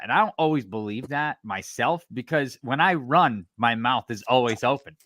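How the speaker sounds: ordinary talk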